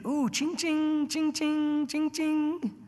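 high, slow sing-song "ching, ching"; cash register ching. Pretend. Preacher mocking Balaam's greed with a high, slow cash-register "ching". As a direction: mimic a cash register, gleeful about money.

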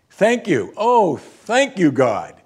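high, emphatic outpouring of gratitude; slow, exclaimed prayer of thanks; fervent thanks. Acted. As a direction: cry out thanks to God. Acted outburst of thanks to God.

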